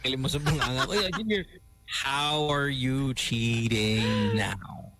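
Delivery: ordinary delivery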